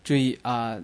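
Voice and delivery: normal talking voice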